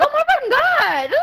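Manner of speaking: high squeal through laughter; laughing retold shock. Retelling getting smacked by a friend, laughing shock. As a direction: squeal in mock outrage, cracking up.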